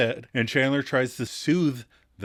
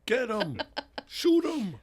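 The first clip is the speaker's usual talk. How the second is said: mock egging on; 9 semitones higher, 5 dB quieter than usual. Cohost joins the egging on.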